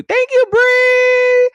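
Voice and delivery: very high, loud, long-held thanks; excited shout-out. Real, not acted. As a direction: holler a drawn-out thank-you. Shouting out a gift.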